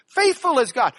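"Faithful" thrown out high and loud; stress on faithful. Shown as a demonstration. Preacher repeats Paul's emphasis, emphatic stress on "Faithful". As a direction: proclaim it, leaning hard on "Faithful". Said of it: "That's his emphasis on this sentence".

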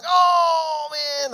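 put-on high, loud cry of delight at a baby; a parent thrilled their baby copied them; delighted oh man. Parent cheering baby's copied face, high, loud delight.

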